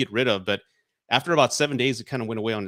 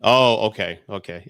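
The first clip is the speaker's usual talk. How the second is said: normal talking voice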